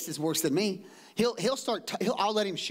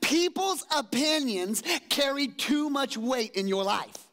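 slow, tender fatherly rebuke; the Lord gently correcting his son, slow; 7 semitones higher, slower than usual. Retold or imitated. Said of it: "He said".

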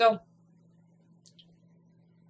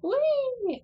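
high whee. Real, not acted. Jumping away in game.

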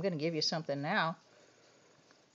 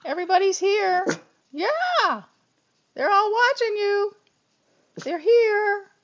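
much higher, louder, quick excited pet talk; excited high squeal; 12 semitones higher, 8 dB louder than usual. Real, not acted. Owner telling her dog everyone is watching, excited and high.

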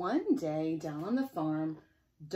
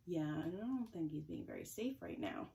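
plain reading voice

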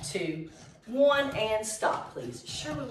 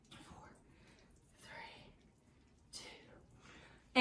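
counting in a whisper; whispered countdown; 20 dB quieter, much slower than usual. Real, not acted.

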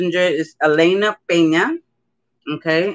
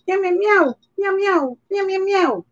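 puppy meows; 13 semitones higher than usual. Pretend. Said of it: "Look at it, you see?".

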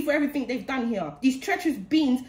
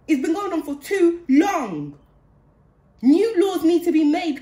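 slow, louder angry outburst; real anger; 3 semitones higher, 5 dB louder, slower than usual. Real, not acted. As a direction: hammer each word home out of frustration. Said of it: "I'm so angry".

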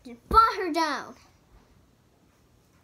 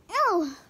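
pained ow; 5 semitones higher, 6 dB louder than usual. Acted. Character gets hit.

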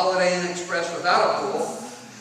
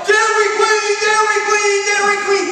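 much higher, louder goofy yell; 13 semitones higher, 7 dB louder than usual. Retold or imitated. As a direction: belt it out like a silly dad. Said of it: "and I yell".

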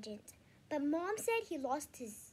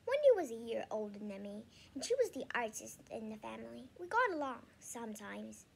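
ordinary delivery

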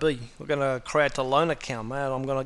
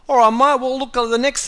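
loud Aussie call; 8 semitones higher, 8 dB louder than usual. Acted. Loud restart of tutorial.

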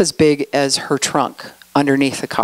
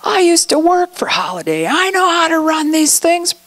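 angry retort; 10 semitones higher than usual. Retold or imitated. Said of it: "she's like".

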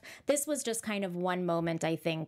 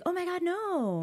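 dismay; 11 semitones higher than usual. Retold or imitated. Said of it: "I was like".

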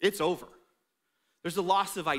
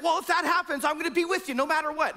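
high, loud, fast pledge; eager boast; 8 semitones higher, 4 dB louder, faster than usual. Retold or imitated. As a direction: brag your loyalty quickly. Pete boasting loyalty.

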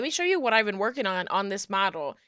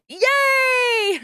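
high cheer; 6 dB louder than usual. Pretend. Magical girl transformation cheer.

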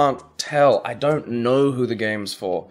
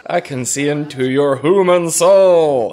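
slow, loud, booming build to the last word; hammed-up alien officer; 4 semitones higher, 6 dB louder, much slower than usual. Mocking the game's creepy officer, hammed-up alien voice. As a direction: declaim it like an alien villain.

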